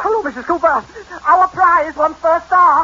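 high-pitched, elated news over the phone; thrilled, stretched-out proclamation; excited announcement. Acted. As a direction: burst out with the big news.

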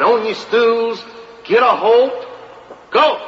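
mild mock holler of a contest judge; hollered command. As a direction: call the start like a county fair judge. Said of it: "The judges hollered".

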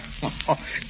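quiet scoffing breath laugh; dismissive laugh. Acted. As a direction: brush it off with a laugh. Scoffs at the warning.

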